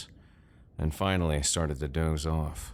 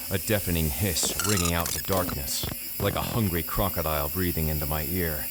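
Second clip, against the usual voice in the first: usual speaking voice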